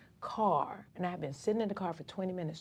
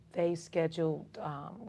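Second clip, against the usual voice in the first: ordinary talk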